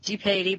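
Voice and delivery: Dipati said "dee-PAY-tee"; long A. Shown as a demonstration. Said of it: "long A".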